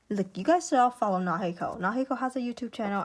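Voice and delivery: ordinary delivery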